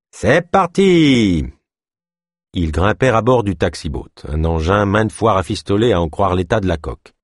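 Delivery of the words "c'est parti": high, drawn-out departure cry; exuberant call. A narrator's reading. Boatman sets off with passengers, calling out exuberantly. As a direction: sing out the send-off slowly and high.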